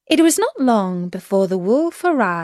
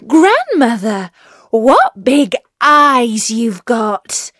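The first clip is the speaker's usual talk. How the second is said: put-on loud, slow, higher child's wonder; amazed little girl; 3 semitones higher, 7 dB louder, slower than usual. A narrator's reading. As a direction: draw out the astonishment as a girl. Amazed child questions grandmother.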